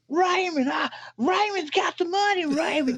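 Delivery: mock a frantic woman pleading in falsetto. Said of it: "she starts to escalate the situation".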